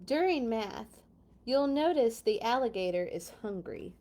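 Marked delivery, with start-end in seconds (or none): none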